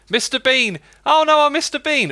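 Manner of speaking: high, loud mock panic; hammy silly voice. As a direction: squeal it like a cartoon. Hammy Mister Bean bit.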